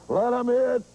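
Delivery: first baseman hollering to his pitcher from the bag; hollered taunt. Retold or imitated. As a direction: chatter from first base like a cocky fielder.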